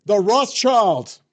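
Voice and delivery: hammed-up slow pronouncement of a name; mock solemn reveal. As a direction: drag out the name like a big reveal.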